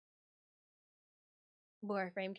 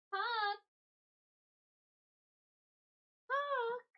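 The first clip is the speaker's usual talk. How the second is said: calling the cat; 13 semitones higher than usual. Real, not acted.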